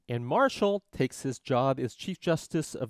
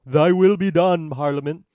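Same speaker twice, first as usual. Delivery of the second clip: loud, raised proclamation; booming judge; 3 semitones higher, 11 dB louder than usual. Acted. Judge submitting to Parliament's law, in a booming voice.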